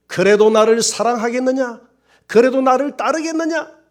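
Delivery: high, loud, solemn call from above; God's voice ringing out high and strong; commanding voice of God. Acted. God's voice asking the pastor.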